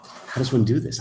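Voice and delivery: ordinary delivery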